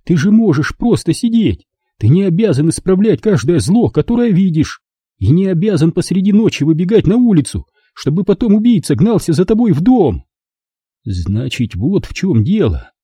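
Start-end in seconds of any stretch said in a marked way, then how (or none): 0.0-10.2 high, upset mother's voice; scolding mother. Acted. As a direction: lay into him as his mother. Mother scolding her son. (мать отчитывает сына, высоким голосом)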